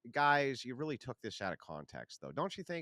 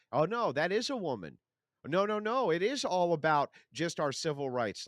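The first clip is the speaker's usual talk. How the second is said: higher, louder sarcastic whine; mocking parody; 5 semitones higher, 6 dB louder than usual. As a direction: sneer as you parody them. Mocking leftists' claims.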